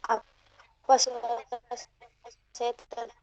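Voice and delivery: glitchy breaking connection; choppy audio. Real, not acted. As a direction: voice cutting in and out. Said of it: "agak putus-putus suaranya".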